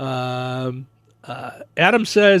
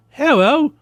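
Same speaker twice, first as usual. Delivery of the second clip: mock British; 9 semitones higher than usual. Shown as a demonstration. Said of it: "fool a, a British yokel".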